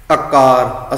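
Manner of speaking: ਆਕਾਰ said akaar, first a short; shortened first vowel. Shown as a demonstration. Teacher quoting how we misread it, short first a in ਆਕਾਰ. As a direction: clip only the opening vowel of ਆਕਾਰ. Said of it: "ਅਸੀਂ short ਵਿੱਚ ਕਰਤਾ".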